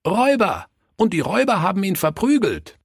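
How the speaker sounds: put-on high, excited child voices; eager children chiming in. A narrator's reading. Children finishing the robber story for Pastor, eagerly chiming in. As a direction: chime in fast and high like children.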